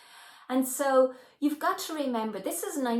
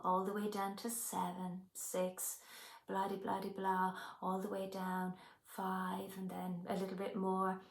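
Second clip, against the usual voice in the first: soft, hushed hypnosis script voice; a soothing countdown that drifts much softer; hypnotist's countdown; 2 semitones lower, 7 dB quieter than usual. Shown as a demonstration. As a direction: lull the client down the staircase, quietly. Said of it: "I'm doing the typical script".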